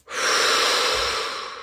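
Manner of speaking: steady whooshing breath out; long exhale. Shown as a demonstration. Guide pushing beach ball underwater, long exhale. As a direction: let the breath pour out audibly.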